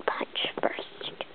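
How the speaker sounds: airy pretend whisper. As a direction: whisper it to a friend. Doll whispers at party.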